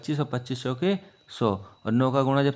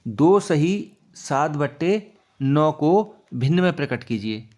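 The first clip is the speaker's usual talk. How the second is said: slow question reading; 3 dB louder, slower than usual. Shown as a demonstration. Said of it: "next सवाल है हमारा".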